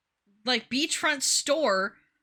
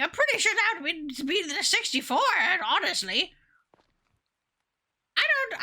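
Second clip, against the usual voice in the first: high grandma voice, indignant and lively; 10 semitones higher than usual. Pretend. Streamer protests that Ellen never dies, grandma voice. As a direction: stay in a put-on grandma voice while protesting. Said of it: "talking in grandma voice".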